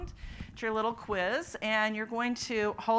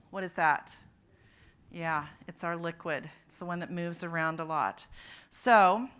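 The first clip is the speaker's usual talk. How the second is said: ordinary talk, nothing marked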